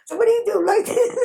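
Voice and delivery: high, quick mimicking question; amused mocking question. Coworker mocking Terry's head shake, amused. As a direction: shoot up high, about to break into laughter. Retold mock question, then laughs.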